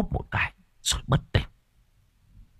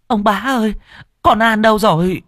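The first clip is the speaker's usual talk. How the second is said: put-on much higher, pressing old mother's voice; urgent old woman; 13 semitones higher, 4 dB louder than usual. Old mother bursting into the yard asking for her daughter, much higher and urgent. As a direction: demand an answer, desperate.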